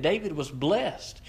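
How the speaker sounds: ordinary talk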